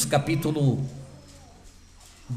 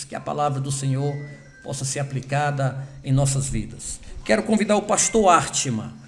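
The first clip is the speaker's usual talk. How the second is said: ordinary talk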